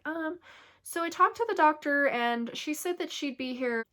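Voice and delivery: a hint of a chirpy nurse. Nurse voiced in birth story.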